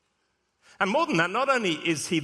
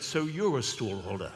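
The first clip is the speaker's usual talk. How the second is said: lower, softer royal voice; a hint of Charles, lower and hushed; Prince Charles impression; 5 semitones lower, 4 dB quieter than usual. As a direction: murmur like a polite royal. Vicar imitates Prince Charles speaking.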